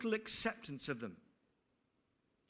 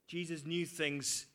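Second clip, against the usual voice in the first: ordinary talk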